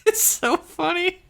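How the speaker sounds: high, squeaky laughing voice. Real, not acted. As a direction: giggle through the words. Cracking up over the scene.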